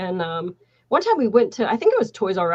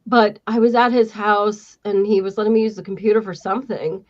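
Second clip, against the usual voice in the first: usual speaking voice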